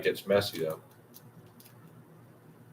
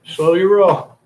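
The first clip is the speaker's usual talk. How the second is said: loud mock warning, high and punchy; hammed-up loud warning from friends; friends telling him to ease up; 8 semitones higher, 11 dB louder than usual. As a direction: bark it out like a friend reining you in. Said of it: "they're like".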